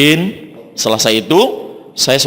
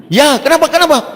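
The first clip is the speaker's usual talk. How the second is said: much higher, worried questioning; anxious reply; 12 semitones higher than usual. Retold or imitated. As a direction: ask anxiously, fearing the worst. He answers the alarming call.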